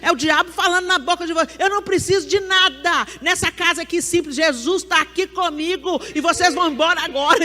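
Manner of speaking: loud, high defiant declaration; acted shouted rebuke. Preacher re-enacts sending visitors out of the house, shouted rebuke. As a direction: raise the voice and order them out.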